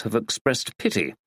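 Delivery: plain reading voice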